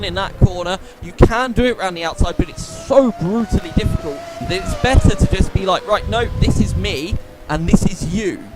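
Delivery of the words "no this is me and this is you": ordinary talk, nothing marked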